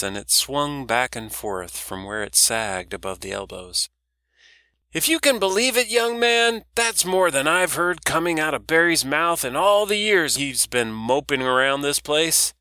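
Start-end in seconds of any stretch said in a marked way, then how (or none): 4.9-12.5 put-on much higher, louder gossiping old dock lady; a loud old woman teasing about moping Barry; brash old woman. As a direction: rib the young man like a loud old auntie. Doris voiced by narrator.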